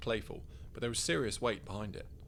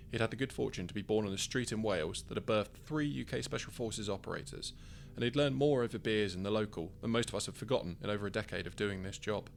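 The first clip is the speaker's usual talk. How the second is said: ordinary delivery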